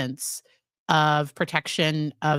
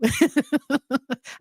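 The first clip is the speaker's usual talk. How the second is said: real high-pitched giggle; self-conscious laugh; 8 semitones higher than usual. Real, not acted. Psychologist out of her usual field, giggling it off.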